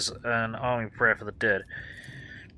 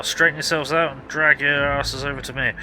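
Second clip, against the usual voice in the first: higher, louder gruff soldier voice; barking warden sergeant; 4 semitones higher, 5 dB louder than usual. Acted. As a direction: play a harried frontline sergeant yelling at newcomers. Sergeant voice again.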